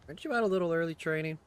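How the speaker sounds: high, loud, quick playful friend; teasing friend voice. Acted. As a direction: tease him like an old friend.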